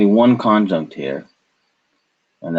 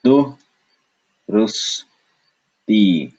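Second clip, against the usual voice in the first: "Du, rus, ti" as three separate beats; syllable by syllable; 2 semitones higher, much slower than usual. Shown as a demonstration. Teacher showing the chosen split, "Du, rus, ti" in beats. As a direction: pause after "Du" and "rus". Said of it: "we chose to say du".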